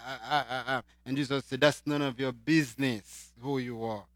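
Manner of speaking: normal talking voice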